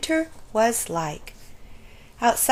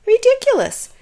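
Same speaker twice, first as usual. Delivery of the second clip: high, loud scornful meow; meowing cat; 14 semitones higher, 7 dB louder than usual. A narrator's reading. Cat scoffing from the tree, high and loud. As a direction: sneer like a cat. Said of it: "meowed the cat".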